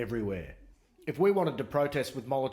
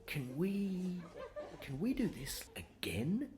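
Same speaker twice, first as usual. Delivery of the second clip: much softer, slow sly politician; scheming politician; 3 semitones higher, 10 dB quieter, slower than usual. Retold or imitated. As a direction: plot it quietly like a smug official. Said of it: "they're like".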